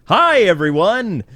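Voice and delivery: loud, high, drawn-out showman greeting; fake cheery. Bruce Wayne boarding the boat in character, fake showy cheer. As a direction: greet the crowd with a put-on smile. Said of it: "he's smiling all fake".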